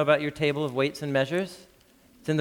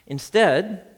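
ordinary delivery

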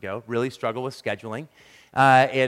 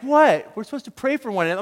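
startled protest; 8 semitones higher, 5 dB louder, faster than usual. Retold or imitated. Friend's startled defensive reply.

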